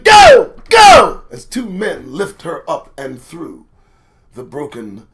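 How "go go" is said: loud high mock cheer; yelling. Babbitt yelling to the crowd, loud mock cheer. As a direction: shout it like egging the crowd on. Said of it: "like a cape and yelling".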